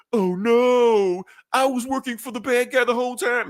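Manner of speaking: high, hammed-up gasp of dismay; mock shocked realization. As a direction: act out the sudden shock of betrayal.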